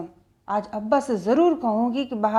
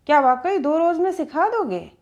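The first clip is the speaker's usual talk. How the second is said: eager question; 6 semitones higher than usual. A narrator's reading. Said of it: "मैंने इश्तियाक यानी उत्सुकता से पूछा".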